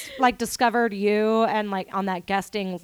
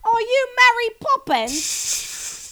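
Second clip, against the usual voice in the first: high, slow put-on Cockney accent; drawn-out mock Cockney teasing; 13 semitones higher, much slower than usual. American host teasing British guest, mock Cockney accent.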